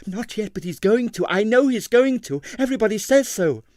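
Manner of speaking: high, pressing, fearful; insist, voice climbing with worry; anxious insistence. A narrator's reading. Distressed ballerina insists.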